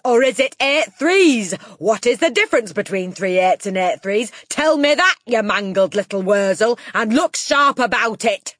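loud, high, raging tirade; Trunchbull. A narrator's reading. Headmistress correcting a boy's multiplication, furious yelling. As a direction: yell it emphatically, pounding the key words. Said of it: "yelled the Trunchbull".

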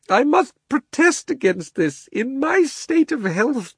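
high, drawn-out self-pitying wail. A narrator's reading. Uncle Andrew protesting in the darkness, wailing. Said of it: "it was wailing on".

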